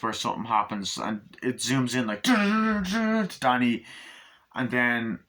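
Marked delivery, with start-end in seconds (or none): 2.1-3.3 high, slow sung dun dun; mimicking a horror soundtrack; imitated music stings. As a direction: hum the creepy score hits.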